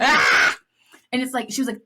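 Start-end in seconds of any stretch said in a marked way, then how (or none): 0.0-0.6 loud breathy startled "ah"; startled yelp. Retold or imitated. Jeff startled by Tina's voice, a breathy yelp. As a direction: jump like you got caught off guard. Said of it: "he's like".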